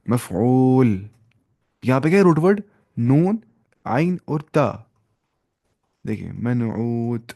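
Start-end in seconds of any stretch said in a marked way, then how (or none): 0.1-1.1 मफ़ऊल stretched out slowly, syllable by syllable; slow demonstration. Shown as a demonstration. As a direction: draw out मफ़ऊल for the class. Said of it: "मफ़ऊल के वजन पे". (मफ़ऊल को धीरे-धीरे खींचकर बोलना)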